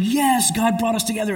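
high, delighted cry of thanks; overjoyed rejoicing. Retold or imitated. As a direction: sound like the answer to a prayer. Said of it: "he rejoices".

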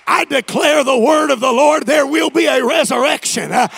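loud, high preaching climax; full-force preaching. Acted.

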